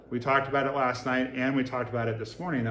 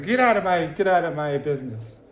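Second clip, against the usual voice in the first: defensive snap; 4 semitones higher, 6 dB louder than usual. Retold or imitated. Trauma default pushing spouse away.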